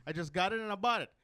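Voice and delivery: normal talking voice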